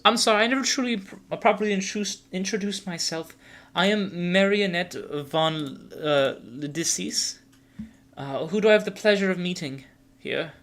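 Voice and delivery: put-on high-pitched, genteel young vampire; Marionette, courteous and formal. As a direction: introduce yourself politely in a high voice, slowing on the name. Game master voices Marionette.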